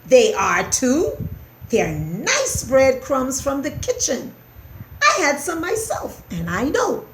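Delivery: affronted mouse; indignant protest. A narrator's reading. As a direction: argue back indignantly. Ced disputes what he is told.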